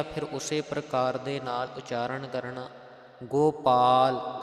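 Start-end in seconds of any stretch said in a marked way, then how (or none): 3.2-4.4 ਗੋਪਾਲ slow and loud, with a long o on ਗੋ; pronunciation demo. Shown as a demonstration. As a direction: draw out the o in ਗੋਪਾਲ. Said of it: "ਗੱਗੇ ਨੂੰ ਹੋੜਾ ਲਗਾ ਕਰਕੇ".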